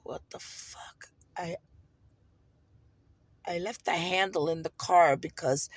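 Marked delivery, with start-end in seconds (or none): 0.0-1.1 whispered, drawn-out reaction; stunned whisper to yourself; hushed disbelief. Retold or imitated. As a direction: say it under your breath, slowly. Retelling her stunned reaction.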